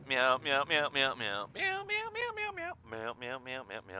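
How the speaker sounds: playful meowing, jumping up very high; cat meows. Pretend. Streamer meowing back at chat, playful cat voice.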